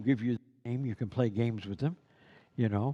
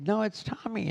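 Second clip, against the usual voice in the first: put-on high exasperated kids; 10 semitones higher than usual. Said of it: "they finally say".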